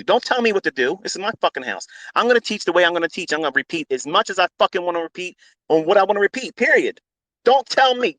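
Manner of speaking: heated rant. Real, not acted. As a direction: tell them off at full heat. Ranting at complaining students.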